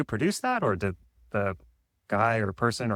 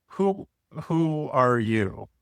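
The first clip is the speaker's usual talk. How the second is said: slow drawn-out; much slower than usual. Pretend.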